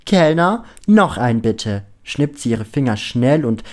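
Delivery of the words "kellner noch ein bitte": loud call, high on the first word. A narrator's reading. Penelope ordering another from the waiter, loud call. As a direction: holler across to the waiter.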